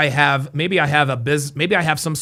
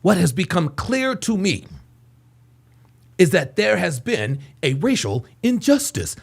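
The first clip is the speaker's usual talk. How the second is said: imitation of a measured speechmaker; mock oratory with long pauses; mocking fake inflections. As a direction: put on a solemn, halting public-statement cadence. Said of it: "this dude does not talk like this".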